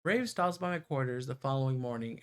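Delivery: usual speaking voice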